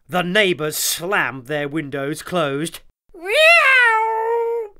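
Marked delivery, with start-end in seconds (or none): 3.1-4.7 high, loud yowling meow; cat meow. A narrator's reading. The cat yowling at the screaming, high loud meow. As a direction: yowl like a startled cat. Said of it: "went the cat".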